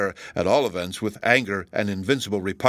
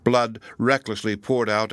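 usual speaking voice

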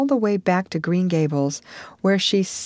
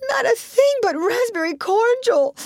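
put-on high sobbing girl's answer, much higher. Anne sobs her reply to Marilla. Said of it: "sobbed Anne".

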